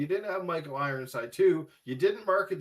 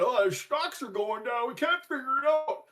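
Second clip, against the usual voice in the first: imitating whiny Ubisoft executives, higher; mock crying executives; 8 semitones higher than usual. Mocking Ubisoft bosses crying over stocks, whiny and high. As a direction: whine it out like a fretting exec. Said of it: "Ubisoft creeps crying".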